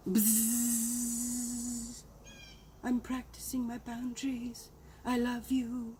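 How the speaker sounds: quiet buzz then hushed sweet words; soft bug buzz. Shown as a demonstration. Buzzing softly at the brother-in-law. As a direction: hum it off gently, almost whispering. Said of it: "demo of buzzing off".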